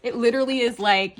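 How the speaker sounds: ordinary talk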